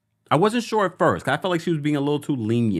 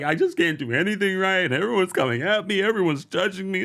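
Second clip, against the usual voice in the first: mock whiny Camille; 6 semitones higher than usual. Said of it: "She's like".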